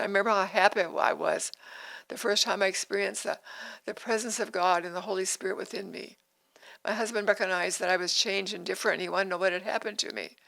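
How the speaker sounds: ordinary delivery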